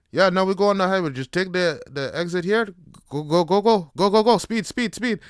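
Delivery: hammed-up coach yelling to speed up; urging shout. Instructor urging onto highway.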